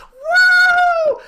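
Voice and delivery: very high, loud "woo"; long screaming whoop of a thrill-seeker; exultant whoop. Retold or imitated. Red Bull cameraman cheering in free fall, shrieked whoop.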